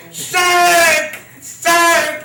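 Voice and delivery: high, loud repeated cry; screaming. Retold or imitated. Brother pointing from the corner, high loud screams. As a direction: keep shouting it down the block.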